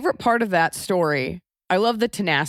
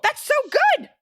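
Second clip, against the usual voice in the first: very high, delighted praise; amazed; faster than usual. Real, not acted. Host praising a guest's impression, thrilled. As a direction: squeal with real admiration.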